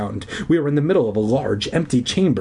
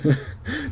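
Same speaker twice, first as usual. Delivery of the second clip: nervous laugh; 13 semitones higher, 4 dB quieter than usual. A narrator's reading.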